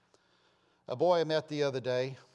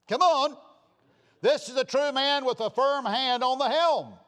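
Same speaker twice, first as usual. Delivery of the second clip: louder and higher, commanding; stern father; 10 semitones higher, 8 dB louder than usual. Retold or imitated. Preacher voicing a father ordering his son, loudly. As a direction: bark it like a firm father. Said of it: "and said, Listen".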